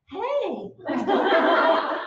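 loud high mock-friendly greeting; fake-cheery hey. Mom backing up to greet the teacher, loud and fake-cheery. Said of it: "I go".

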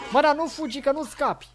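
high-pitched taunt at fleeing prey; playful high-pitched threat; mock threat.

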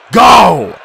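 loud, high battle shout; yelled command. A narrator's reading. Chiron ordering the hero to charge, urgent yell. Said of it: "Chiron yelled to me".

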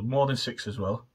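talking while finishing a bite; muffled by hand at lips; fingers at mouth, eating. Real, not acted. Seen 4: fingers at lips first 1.4 s, then talks, chewing unclear.

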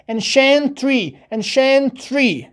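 ancientry very slowly, "en-shen-tree"; cient as "shent". Shown as a demonstration. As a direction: draw out every syllable of ancientry. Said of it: "تلفظ شنت".

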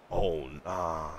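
usual speaking voice

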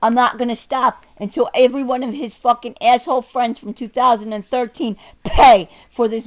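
ordinary talk, nothing marked

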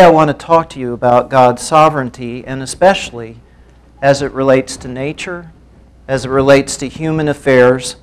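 ordinary delivery

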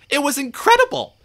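high, loud amazed cry; excited exclamation. A narrator's reading. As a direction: exclaim it, bursting with wonder. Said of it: "exclaimed James".

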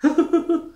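high-pitched little giggle; mocking giggle. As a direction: keep giggling at the other's expense. Still laughing at the cord.

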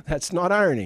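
ordinary talk

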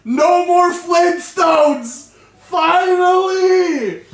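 high, loud, slow triumphant cheer; joyful shout. Real, not acted. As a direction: celebrate like you won. Relieved cheering.

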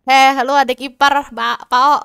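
loud, rough-and-ready hail; calling out. Pretend. Player hailing a brother-in-law on sight, calling out loudly. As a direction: holler to get the in-law's attention.

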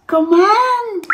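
high, bright little raindrop voice calling out; a cheerful raindrop calls to the boy, high and lively. Acted. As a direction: sing out like a tiny drop of water. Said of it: "riep het regendruppeltje".

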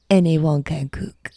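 put-on playful French accent; light Gusteau French accent. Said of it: "I'm gonna do my best French accent".

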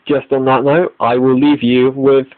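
ordinary talk, nothing marked

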